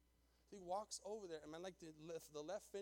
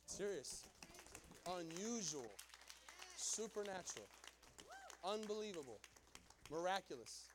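emphatic preaching; 2 semitones higher than usual. Real, not acted. Pastor marvels to the congregation at the offer, emphatic. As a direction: punch out each word with a pause after it. Preacher exclaims one word at a time.

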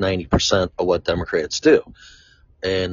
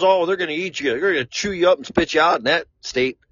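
higher, sing-song imitation of a warning; mock ominous stranger; 8 semitones higher, faster than usual. Stranger warns him Colorado Democrats will eat him, higher mock voice. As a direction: play the stranger's dire prediction, pitched up.

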